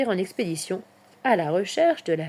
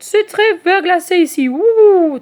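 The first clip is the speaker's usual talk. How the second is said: high, loud owl warning ending in a hoot; Charlotte; 10 semitones higher, 12 dB louder, slower than usual. A narrator's reading. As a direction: warn like a hooting owl. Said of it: "les avertit Charlotte". (voix de chouette qui avertit)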